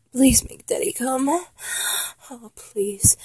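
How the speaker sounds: breathy, needy pleading; begging. Acted. Lover pleading with partner, begging. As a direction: beg in short, breath-broken bursts. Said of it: "I'll stop begging".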